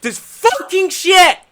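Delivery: high, loud, slow disgusted outburst; real angry cursing; livid. Real, not acted. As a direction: spit it out in fury. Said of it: "I am fucking livid".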